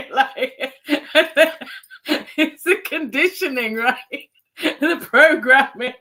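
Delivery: high words broken up by real laughter; real laughter spilling over every phrase; laughing. Real, not acted. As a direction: giggle through the punchline. Laughing at her own joke.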